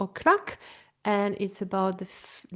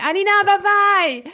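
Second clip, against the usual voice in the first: high, loud sing-song bye-bye; excited call-out; 10 semitones higher, 9 dB louder than usual. Real, not acted. Host calling goodbye to Alina, excited and loud.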